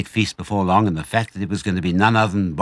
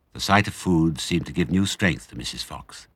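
ordinary delivery, nothing marked

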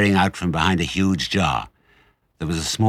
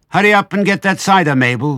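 high, loud call through the house; shrill, bossy summons; woman calling out; 11 semitones higher, 8 dB louder than usual. A narrator's reading. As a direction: holler an order from upstairs. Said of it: "a woman's voice calling out".

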